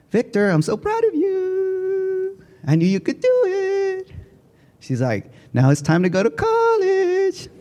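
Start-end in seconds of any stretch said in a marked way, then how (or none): 0.1-4.1 much higher, slow, teary mock voice of a proud teacher; teacher's proud voice. Teacher telling Victor she's proud, high and teary. 5.5-7.5 much higher, slow, teary mock voice of a proud teacher; teacher's proud voice. Teacher telling Victor she's proud, high and teary.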